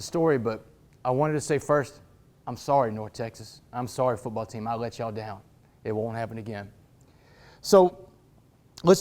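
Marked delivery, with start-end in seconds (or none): 2.5-6.7 low, hushed sincere apology; solemn apology. Real, not acted. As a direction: own up to it, softly and low. Solemn apology to his old team.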